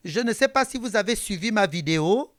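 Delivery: ordinary talk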